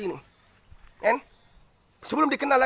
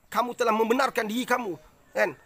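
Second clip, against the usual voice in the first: ordinary delivery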